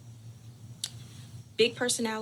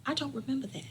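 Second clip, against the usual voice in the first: mother's voice; 5 semitones higher, faster than usual. Retold or imitated. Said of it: "and she has said".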